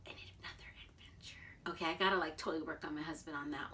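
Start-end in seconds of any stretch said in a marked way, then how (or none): 0.1-1.4 breathy secret whisper to herself; whispered aside. Real, not acted. Vlogger whispering a private wish to viewers, hushed.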